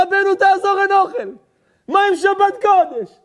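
high, loud collective plea; townspeople's outcry. Acted. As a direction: wail out the whole town's appeal. Whole town begs the rabbi. (זעקת תחינה של עיירה שלמה)

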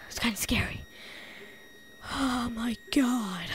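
hushed scared whisper. Pretend. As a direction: whisper it so nothing hears. Whispering fear in a cave.

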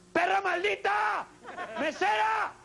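high shrill furious yell; hammed-up high-pitched tantrum; mock rage. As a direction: explode at the slow waitress. Raging at slow waitress.

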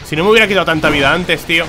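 loud and exasperated; exasperated complaint to the chat; frustrated lament. Real, not acted. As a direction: moan loudly about the lost health. Lost to boss, frustrated.

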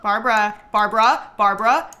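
loud repeated name call; loud name, lifting at the end on the repeats. Pretend. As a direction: call the name three times, insistently. Calling to a game character.

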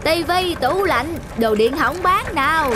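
high drawn-out hawker's call, slow; street vendor call. Acted. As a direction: sing it out like a scrap buyer down the lane. Scrap buyer calling through street. (tiếng rao kéo dài của người mua đồ cũ)

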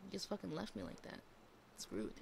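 ordinary talk

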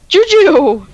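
loud call across the map. Real, not acted. Calling out to teammate.

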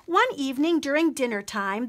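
plain reading voice

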